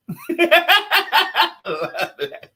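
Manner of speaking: loud burst of laughter; real hearty laugh. Real, not acted. Laughing at [name] joke.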